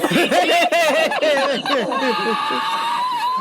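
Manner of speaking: loud, high burst of helpless cackling; shrieking laugh. Real, not acted. Storyteller cracking up at the sorry ending, shrieking laugh. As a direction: explode into long squealing laughter.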